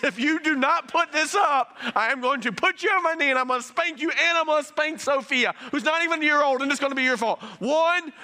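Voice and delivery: high, loud exasperated mom counting to three; mother threatening her son Briggs, high and loud; mock mom threat. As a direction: play the fed-up parent warning her toddler. Parent threatening child, counting.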